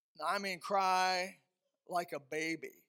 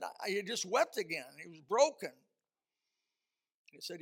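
ordinary talk

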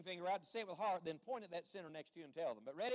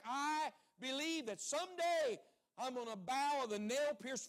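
raised-pitch testimony from the pulpit; impassioned preaching; 3 semitones higher, 3 dB louder than usual. Real, not acted. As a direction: build into a fervent declaration of belief. Preaches at full force.

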